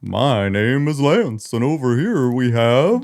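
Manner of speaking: slow, lightly voiced Santa. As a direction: play Santa hosting, unhurried. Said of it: "I can't do a friendly Santa voice".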